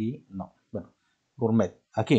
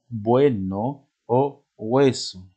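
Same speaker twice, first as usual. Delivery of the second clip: slow dictation; 3 dB louder, much slower than usual. Shown as a demonstration. Teacher dictating example words.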